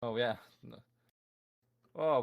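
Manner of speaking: normal talking voice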